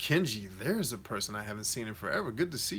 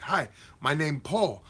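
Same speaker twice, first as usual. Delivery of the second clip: put-on higher, slow Chinese-accented English; imitated Chinese accent; 4 semitones higher, slower than usual. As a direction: imitate a Chinese coworker introducing himself. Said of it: "I'm imitating how he sounded".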